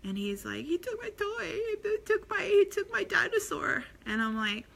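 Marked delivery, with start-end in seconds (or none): 0.7-3.8 mock toddler wail, very high; upset crying child. Parent imitating a small son complaining to a parent, high upset whine. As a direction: imitate a tearful little boy tattling. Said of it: "he was crying, and he was upset".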